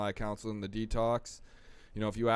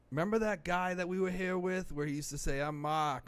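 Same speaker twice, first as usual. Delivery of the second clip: mock Boston accent; 9 semitones higher than usual. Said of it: "'cause he had this wicked Boston accent".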